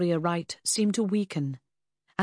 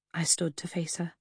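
usual speaking voice

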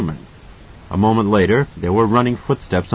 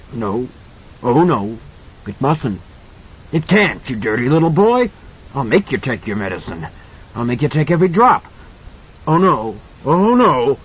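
panicky, pleading creature voice; desperate monster; 2 semitones higher than usual. A narrator's reading. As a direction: whine then snarl threats. Monster begs and threatens.

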